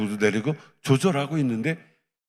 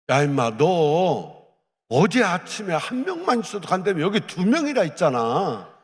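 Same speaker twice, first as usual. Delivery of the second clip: God scolding; 6 semitones higher than usual. Retold or imitated. God rebukes the preacher.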